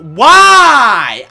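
high, loud drawn-out "why"; exasperated yell; anguished gamer protest. Real, not acted. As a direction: wail it in outrage at losing. Killed unfairly in game.

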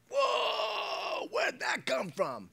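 high, drawn-out shocked whoa; hammed-up astonishment, slow and high; startled bystander. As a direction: react as if blown back by an outburst. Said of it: "everybody around you's like".

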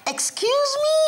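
very high, loud scoffing question; mocking. Mongolo mocking the bee's excuse me, high and scornful. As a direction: throw the words back mockingly. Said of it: "in between mocking laughter".

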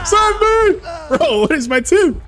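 high, loud cry for help; desperate shout. Real, not acted. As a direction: yell for rescue in panic. Downed, begging teammates.

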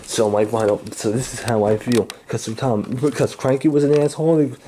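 low brooding threat, lightly voiced; gruff villain voice. Plays the angry crane character.

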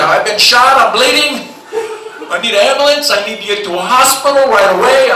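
acted alarm, pleading for help; panicked caller. Wounded caller begs operator for help, acted panic. Acted emergency call by shot man.

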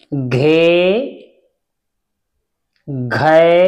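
each syllable said alone with long pauses between; syllable drill. Shown as a demonstration. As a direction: recite the barakhadi one syllable at a time, teacher style. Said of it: "हर एक मात्रा का हमें उच्चारण करना है". (हर अक्षर को रुक-रुककर साफ़ बोलिए)

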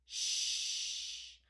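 "sh" drawn out as steady air; long sh sound. Shown as a demonstration. Teacher hearing the word's end, long "sh". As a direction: hold "sh" long, without voice. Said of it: "The sound is long".

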